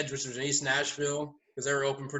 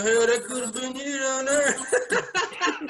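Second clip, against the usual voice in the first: slow, higher, laughing tease; mock teasing; 8 semitones higher, much slower than usual. Friend teasing Carol about the tased man, laughing. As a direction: rib him through laughter. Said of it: "Steve's like".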